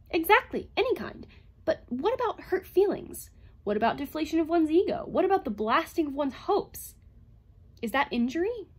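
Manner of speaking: high, insistent questioning; heated argument. A narrator's reading. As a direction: pile up the questions forcefully. Heated argument, piling up pointed questions.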